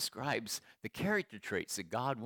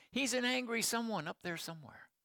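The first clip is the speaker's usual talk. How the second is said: much higher-pitched retold outburst; smile gone; 9 semitones higher than usual. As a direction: imitate her reply, pitched high and unsmiling. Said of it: "the smile was gone".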